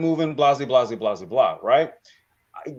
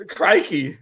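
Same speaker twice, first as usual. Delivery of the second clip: laughing crikey; 9 semitones higher, 3 dB louder than usual. Real, not acted. Echoing the Aussie bit, laughing.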